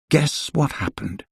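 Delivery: ordinary delivery, nothing marked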